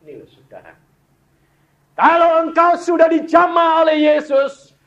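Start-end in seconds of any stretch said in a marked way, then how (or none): 1.9-4.7 high, loud shout of a preacher; fervent preaching shout. Acted. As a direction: cry it out to the whole church. Preacher exhorting the women.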